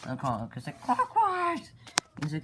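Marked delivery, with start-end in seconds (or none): 0.8-1.7 loud squeaky shriek of the name, much higher; a sudden shrill outburst at his partner; shrieking call. Acted. Chronic calls out his partner.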